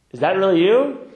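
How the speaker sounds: loud, high, acted disbelief; incredulous calling out. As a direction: call up to heaven, astonished. Joke's man calls up to God.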